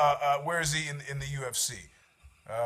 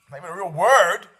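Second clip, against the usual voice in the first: "Not even a real word" said out firmly and louder; louder retort; 5 dB louder than usual. Pretend. Host repeating the objection to the audience, louder and firmer. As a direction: push the words out like a complaint to the room.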